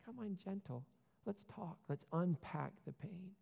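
gentle invitation. Retold or imitated. Jesus to the hurting, softly and gently. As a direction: coax gently toward talking. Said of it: "Jesus is like".

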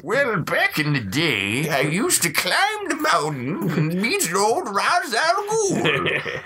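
high, slow raspy prospector; old prospector Batman. Retold or imitated. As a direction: spin a yarn like an old miner. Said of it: "old prospector Batman is awesome".

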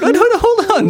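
high, laughing panic; panicked guy, laughing. Retold or imitated.

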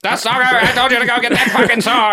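fast, high furious shout; angry witch yell. Retold or imitated. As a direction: scream it at him like a nagging witch. Witch yelling at hero.

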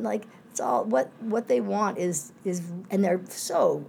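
usual speaking voice

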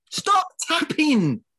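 high, slow, exasperated stop tapping; high, drawn-out complaint; irritated outburst. Real, not acted. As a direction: snap at him to stop the noise. Annoyed at tapping co-host.

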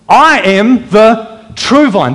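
slow, deliberate build to "true"; measured words with "true" set apart and stressed. Shown as a demonstration. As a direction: spell it out slowly, accenting "true". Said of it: "Emphasis now on true".

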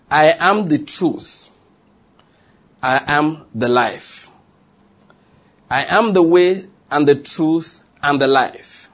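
slow, each phrase weighted on am; slow, stately sermon declaration; slow solemn declaration. Acted. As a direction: lay out each I am slowly and deliberately. Slow emphatic repeated declarations.

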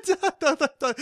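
high, mocking la-di-da sing-song; mocking pharaoh. Pharaoh mocks the time traveller, high sing-song. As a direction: tease the dreamer with a high silly name. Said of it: "And he's like".

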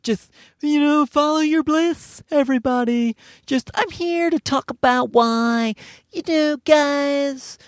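high, drawn-out self-help gush; mock a blissed-out motivational host; gushy positivity guru. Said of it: "she's sort of like a positive-y".

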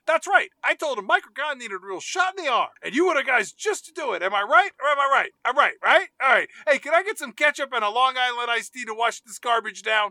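loud, high-pitched obnoxious loudmouth; hammed-up pushy blowhard, barging in; Bob Richmond. Jumping into a business dinner, loud obnoxious bluster. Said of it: "Richmond just jumps in".

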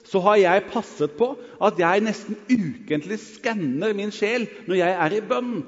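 ordinary talk

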